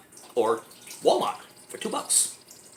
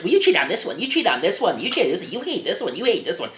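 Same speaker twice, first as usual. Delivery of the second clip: higher, singsong imitation of squabbling; mock bickering; 4 semitones higher than usual. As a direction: mimic petty drama in repeated swooping phrases. Mimics beach characters' repetitive bickering.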